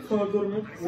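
normal talking voice

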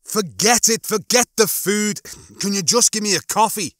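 high-pitched fed-up request; worked-up, pleading frustration; exasperated plea. A narrator's reading. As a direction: give up arguing and plead with the machine. Lister exasperated with the machine.

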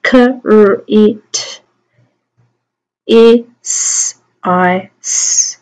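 phonemes of criticize, each isolated; "K, R, I, T, I, S" as speech sounds; sounding out. Shown as a demonstration. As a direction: separate sounds with small gaps. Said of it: "Let's do it again".

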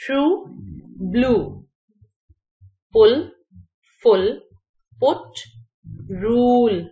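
one word at a time with pauses, long oo in "rule", short in "put"; careful word-list reading, vowels distinct in "luck" and "rude"; correct model pronunciation. Shown as a demonstration. Teacher reading test words so learners hear them, correct model pronunciation. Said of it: "सही pronunciation पता चल जाए".

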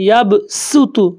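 یب سوتو with a crisp س, drawn out slowly; hard sin sound. Shown as a demonstration. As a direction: hit the س in یب سوتو sharp and thin. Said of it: "یہ سین کی آواز سختی والی".

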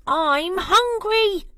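high, loud, slow hungry squirrel cry. Acted. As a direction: wail out your hunger. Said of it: "cried Cyril".

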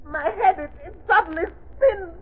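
very high, breathless terror as his head spins; a boy's voice in panic; transforming villain crying out in fear; terrified youth. Acted.

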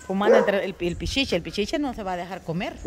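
ordinary talk

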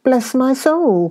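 high, slow startled exclamation; a nephew startled at the door, crying out in surprise; surprised cry. A narrator's reading. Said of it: "cried Fred".

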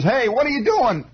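high mock holler out a window. Cousin yelling down from the window, high holler. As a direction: call down from the second story, high. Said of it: "my cousin Buddy hollers out".

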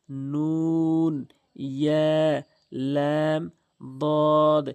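কাফ, সিন, দাল, লাম each drawn out over three alifs; stretched letter names. Shown as a demonstration. Teacher chants the three-alif letters for students to copy, very slow. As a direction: pull each letter name long and even. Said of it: "টেনে টেনে".

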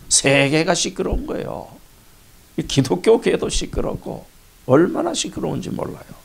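ordinary talk

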